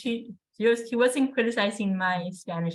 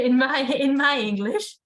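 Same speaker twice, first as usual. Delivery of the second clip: laughing; 4 semitones higher, 6 dB louder, slower than usual. Real, not acted.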